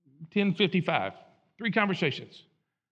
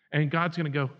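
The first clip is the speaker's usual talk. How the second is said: normal talking voice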